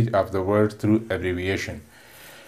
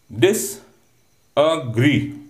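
"Disagree" in three very slow, separate beats; split into syllables; 3 semitones higher, much slower than usual. Shown as a demonstration. Teacher demonstrating three syllables to cadets, "Disagree" slowly broken up. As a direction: pause after each syllable of "Disagree", ending on a rise. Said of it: "There are three syllables".

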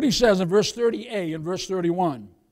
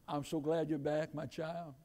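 soft, low and tender; hushed, fatherly murmur of welcome; 5 semitones lower, 15 dB quieter than usual. Acted. God to a returning child, tender and hushed.

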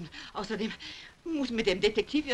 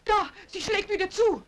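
alarmed; 11 semitones higher than usual. Acted. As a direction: react fast as the door shuts. Door slams shut again.

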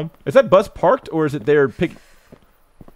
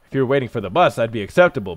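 ordinary delivery

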